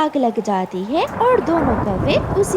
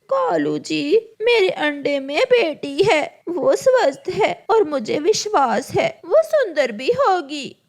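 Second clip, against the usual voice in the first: higher-pitched pleading crow wife; pleading mother crow; 4 semitones higher than usual. Acted. Crow wife tells Kalu about the daughter in her egg, pleading.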